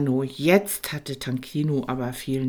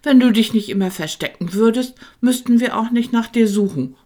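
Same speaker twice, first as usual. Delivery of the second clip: blurted out fast, higher and louder; blurting out; 9 semitones higher, 10 dB louder, faster than usual. A narrator's reading. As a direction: burst out with it all at once. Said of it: "platzte es plötzlich aus Heiner heraus". (Heiner platzt hastig und laut heraus)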